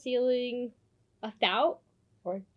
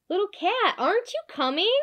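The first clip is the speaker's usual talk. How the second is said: high, raised friendly call; cheerful call across the room; 9 semitones higher, 4 dB louder than usual. Acted. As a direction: call her over. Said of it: "call out".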